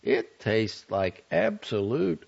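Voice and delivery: irritable. A narrator's reading. Matron of honor grumbling about the drink, irritably. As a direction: drag it out in irritation. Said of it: "she asked irritably".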